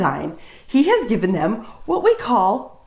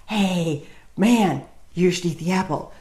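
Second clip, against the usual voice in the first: lightly voiced woman passing on the temptation; a hint of casual peer pressure, kept low-key; woman coaxing; 3 semitones lower, 3 dB quieter than usual. Woman urging the man to eat the apple, lightly imitated coaxing. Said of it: "And then the woman's all".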